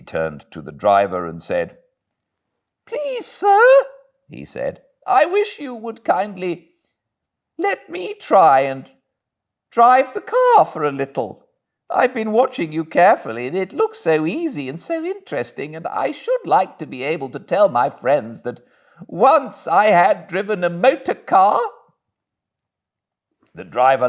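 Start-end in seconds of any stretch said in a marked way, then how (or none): 2.8-3.6 very high, meek Toad voice; playing the humble washerwoman; Toad disguised as washerwoman. 5.1-21.6 very high, meek Toad voice; playing the humble washerwoman; Toad disguised as washerwoman.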